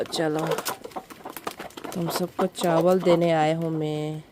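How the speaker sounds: ordinary delivery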